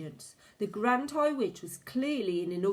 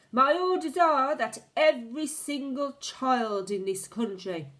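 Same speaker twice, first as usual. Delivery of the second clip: high, loud, slow commanding witch voice; witch giving orders; 5 semitones higher, 7 dB louder, slower than usual. A narrator's reading. The Grand High Witch commands her witches, loud and high. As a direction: bark the orders to wipe out children.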